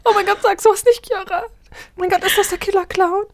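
high shrill-pitched panic throughout; acted terror. Friend terrified the killer clown is here, high panic. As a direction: squeal in horror at the clown.

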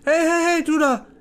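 nosy prisoner. Acted. Prisoner asking cellmate about a sausage, nosy and eager. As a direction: call over like a curious fellow prisoner.

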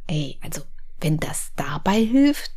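conspiratorial whisper. Retold or imitated. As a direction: whisper it like a sneaky idea. Voicing her own scheming thought.

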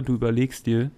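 usual speaking voice

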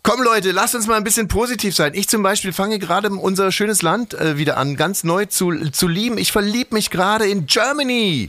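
rally everyone to cheer up; forced cheerfulness. Acted. Host rallies the gloomy co-host, high hammed-up cheer. Forcing positivity after gloomy talk.

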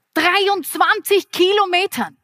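Dreiundzwanzig thrown out high and bright; excited exclamation. Shown as a demonstration.

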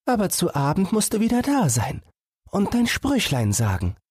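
put-on high girl's voice, anxious for her fawn; caring little sister.

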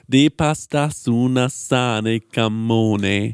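slow made-up Italian gibberish; sing-song fake Italian, drawn out. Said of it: "einfach so in Fantasie-Italienisch".

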